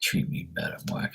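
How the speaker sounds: breathy unvoiced plea, almost silent; whispered plea. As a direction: plead under your breath.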